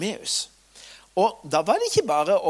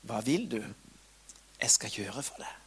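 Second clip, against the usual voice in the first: quiet, softer still, nearly whispered; soft question; 6 semitones lower, 7 dB quieter than usual. Acted. Jesus asking the blind man gently, quietly. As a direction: ask it tenderly. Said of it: "stiller Jesus han dette spørsmålet".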